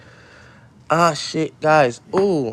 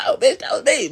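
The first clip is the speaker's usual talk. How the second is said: gloating pig; 11 semitones higher than usual. Retold or imitated. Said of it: "his laughter was a little different".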